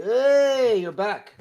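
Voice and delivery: loud, high surprised hey; excited greeting. Real, not acted. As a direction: call out a happy welcome. Greets returning co-host loudly.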